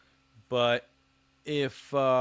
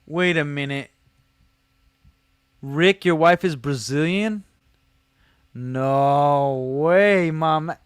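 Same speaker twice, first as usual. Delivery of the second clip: surprised; 3 semitones higher, 6 dB louder, slower than usual. Real, not acted. Learns wife is Brazilian.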